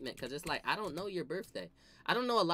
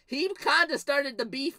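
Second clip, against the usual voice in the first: ordinary delivery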